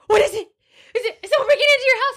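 high, fast, panicky friend voice; imitating worried friend. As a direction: rush it out like a scared friend on the phone.

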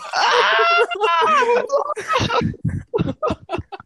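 very high, loud burst of laughter; shrieking laughter. Real, not acted. Storyteller losing it over the falling fry, shrieking laughter. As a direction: burst into real screeching laughter.

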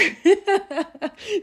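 high giggling laugh; sudden high giggle; high burst of laughter. Real, not acted. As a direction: crack up helplessly. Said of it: "I shouldn't be laughing".

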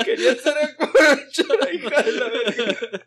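high loud laughing jeer; loud laughing mock insult; laughing taunt. Real, not acted. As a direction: jeer while cracking up.

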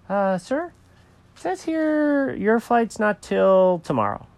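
high-pitched, hesitant airline clerk; check-in lady, hesitant. Acted. Clerk tells the cat his flight is tomorrow, high and hesitant. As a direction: break it to him awkwardly in a high voice.

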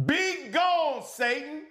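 high, forceful banishing cry; angry command. Shown as a demonstration. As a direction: order the enemy out with frustration. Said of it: "say it with some anger".

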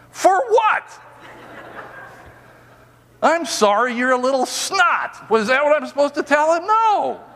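high-pitched, outraged objection; indignant protest. Retold or imitated. Told by the Lord to apologize to grandson, indignant high-pitched protest.

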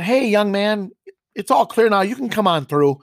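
high, loud, overly polite call to a stranger; polite call-out across the warehouse. Retold or imitated. Host calling the Coca-Cola kid through, loud and overly polite. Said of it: "very politely".

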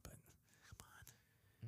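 soft whispered aside; whispering. Real, not acted. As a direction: breathe it out quietly. Whispered aside about hair.

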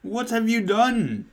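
high, slow, drawn-out wail; mock crying. Dumbledore confronts the students, high mock-tearful wail. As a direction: wail the question slowly, high-pitched. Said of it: "He started to cry wisely".

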